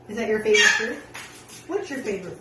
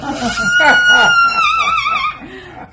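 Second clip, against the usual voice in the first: shrieking laugh; 10 dB louder than usual. Real, not acted. Owner laughs at bird.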